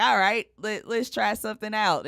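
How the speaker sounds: game, let's-go retelling of a reply; lifted voice for a quoted decision; quoting own eager agreement. Retold or imitated. Quoting own reply when asked.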